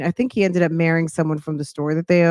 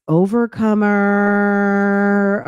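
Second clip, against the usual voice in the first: stretched shout-out; much slower than usual. Real, not acted. Drawn-out name shout-out.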